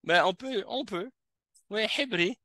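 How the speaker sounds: normal talking voice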